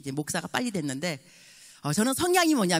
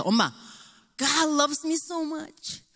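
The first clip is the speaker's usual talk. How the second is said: higher, excited and slow on the English; joyful son; 8 semitones higher, 4 dB louder, slower than usual. Retold or imitated. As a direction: beam with pride about ordination. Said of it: "너무 기쁜 거예요".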